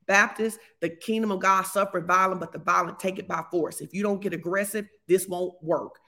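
ordinary talk, nothing marked

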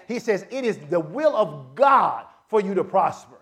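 ordinary talk, nothing marked

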